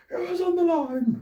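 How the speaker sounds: furious, very high shout protesting a call; indignant courtside tantrum, shouted; John McEnroe outburst. Retold or imitated. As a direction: yell it like a tennis player losing his temper at the umpire. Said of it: "doing a John McEnroe and going like".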